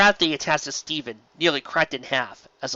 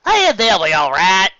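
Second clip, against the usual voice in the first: Applejack voice; 6 semitones higher, 9 dB louder than usual. Acted.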